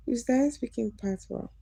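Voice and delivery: ordinary delivery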